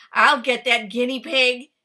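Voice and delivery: loud, furious bark; barking fox. Acted. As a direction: bark the threat after the tricky guinea pig. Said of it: "barked Fox".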